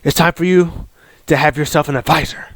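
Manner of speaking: imitating a gruff puppet boss, quick; rough-voiced mock boss; Vince McMahon. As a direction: put on a rasping boss voice. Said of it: "so the Vince puppet goes".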